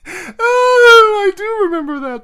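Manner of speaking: long, high, loud "oh" of delight; very high, drawn-out delighted recognition; delighted squeal. Real, not acted. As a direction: squeal with glee as the memory comes back. Delighted squeal at remembered gag.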